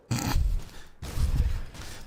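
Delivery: silent wheezing laugh; breathy laugh. Real, not acted. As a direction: laugh under your breath. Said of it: "couldn't even keep a straight face".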